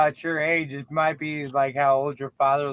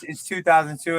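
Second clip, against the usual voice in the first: usual speaking voice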